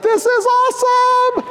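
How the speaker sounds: high, loud, stretched-out thrill; gleeful thrill. Retold or imitated. Dad racing down the slope, loud gleeful thrill. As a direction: squeal it out like a kid flying downhill. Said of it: "it's like".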